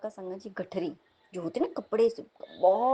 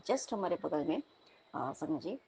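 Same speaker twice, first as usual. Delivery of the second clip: normal talking voice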